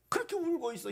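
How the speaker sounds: usual speaking voice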